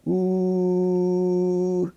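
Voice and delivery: high, long, steady "ooh" on one note; held "ooh" tone. Shown as a demonstration. Teacher testing what the scope picks up, long high ooh. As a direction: sustain a high hummed vowel for the microphone. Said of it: "How about".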